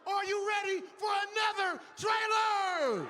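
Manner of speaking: booming motivational speech. Acted. Host hypes crowd, cues trailer.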